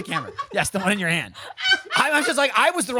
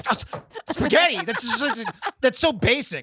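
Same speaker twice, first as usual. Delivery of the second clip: imitating scorn, "spaghetti" thrown back high as a question; mock scorn; 4 semitones higher than usual. Retelling a friend judging the spaghetti order, mock scorn. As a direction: repeat "spaghetti" in higher, scornful disbelief. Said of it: "And she's like".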